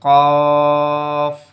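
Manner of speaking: Arabic qaf demo. Shown as a demonstration. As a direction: hit a heavy কফ. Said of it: "তালুর সঙ্গে লাগিয়ে".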